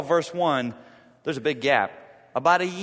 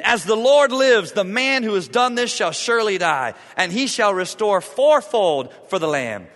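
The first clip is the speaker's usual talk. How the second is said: loud, higher furious vow; angry oath; 6 semitones higher, 10 dB louder than usual. Acted. David swearing to Nathan about the rich man, furious and loud. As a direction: declare the death sentence in a rage.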